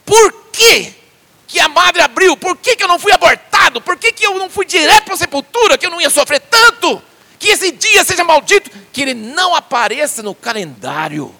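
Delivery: loud, high anguished cry of curses; Job cursing his birthday. Retold or imitated. Job in agony cursing the day he was born, loud and high. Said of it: "Ele falou assim".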